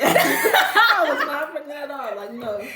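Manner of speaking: high, slow, laughing it out; laughing denial. Real, not acted. Repeating the denial for the camera, laughing hard. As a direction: say it through laughter.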